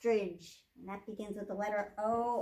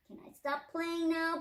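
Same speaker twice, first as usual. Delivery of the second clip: put-on higher, begging young owl; high-pitched storybook plea; pleading Little Hoop; 7 semitones higher than usual. A narrator's reading. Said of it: "pleaded Little Hoop".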